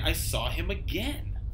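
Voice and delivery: plain reading voice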